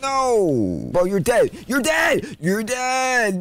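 high, loud panicked yell; panicked shout. Real, not acted. Gamer dying to the boss, shouting in panic. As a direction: yell in disbelief as you die.

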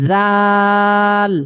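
জাল with the a held very long, slowly; long drawn vowel. Shown as a demonstration. Teacher stretches the vowel for students. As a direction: draw out the জা of জাল. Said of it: "এখানে উচ্চারণটা হবে".

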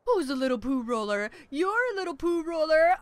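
lightly cooing at a pet. Real, not acted. Gamer cooing lightly at the pet dung beetle. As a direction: coo over the little beetle.

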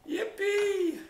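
high, drawn-out whoop of glee; high-pitched carefree whoop; gleeful cheer. Acted. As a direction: cheer like you're free at last. Gleeful cheer at being free.

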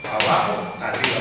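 measured two-beat count, evenly spaced; marking the beat. Shown as a demonstration. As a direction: count steadily like a conductor in two. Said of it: "vamos a marcar el compás".

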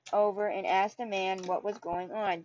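plain reading voice

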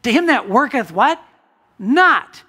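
high, loud preaching climax; preacher pressing the point home, high and loud; rising emphatic. Acted. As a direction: build to a loud high call. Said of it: "Did you hear that?".

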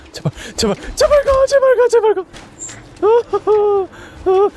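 high frantic begging; panicked pleading. Real, not acted. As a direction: beg loudly in panic. 괴물 지나가길 빌며. (겁에 질린 애원)

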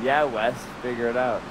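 low mumbling friend impression; mocking friends. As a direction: mutter it like a bored heckling mate. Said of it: "Simon and Ivan be like".

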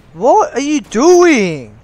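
loud, high, drawn-out disbelief; exasperated shout. Real, not acted. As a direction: yell it in exasperation, stretching the words. Exasperated at another player's move.

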